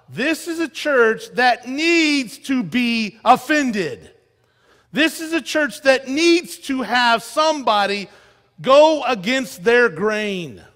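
loud, slow, hammering each phrase; full-force preaching. Acted. Preacher to his congregation, slow loud hammering preaching. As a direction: preach it hard, like the church needs a jolt.

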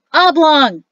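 loud, high call across the room; shouted. Acted. Gazada calls over to singing Oblong, loud shout. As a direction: yell the name to stop him. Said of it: "he shouted".

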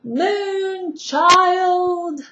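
long, high, sung-out yell of Moonchild; yelled name. Acted. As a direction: call "Moonchild!" out the window at full voice. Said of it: "yells out something".

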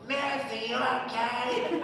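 high imitation of a meddling lady; drawn-out, high mock concern. Lady intervenes in the scene.

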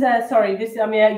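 ordinary talk

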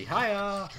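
loud high karate shout; karate yell. Acted. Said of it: "I made a karate noise".

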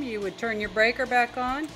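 usual speaking voice

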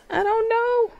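hammed-up panicked squeal; mock panic. Panicking at the puzzle.

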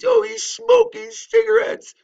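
high, loud whiny mock of his critics; mocking crybaby whine. Mocking critics who complain about his smoking, in a high whiny crybaby voice.

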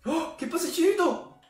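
grito agudo de tensión; exclamación tensa. Real, not acted. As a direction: alarma ante la jugada rival. Jugada tensa en partida. (exclamación alarmada y aguda)

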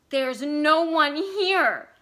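slow, loud, strained stress; wife insisting, strained slow emphasis; queer strained emphasis. Acted. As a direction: press each word, strained. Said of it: "the words with a queer, strained emphasis".

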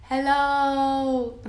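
usual speaking voice

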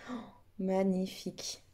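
normal talking voice

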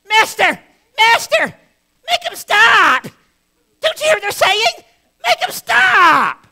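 high, loud, whiny pleading shouts; hammed-up Pharisees begging Jesus; mock desperate complaint; whining Pharisees. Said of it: "they're whining and complaining".

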